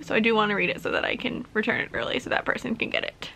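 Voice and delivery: whispered aside, no voice; unvoiced whisper about the waiting reader. Real, not acted. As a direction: drop to a breathy whisper mid-thought. Aside about returning library book.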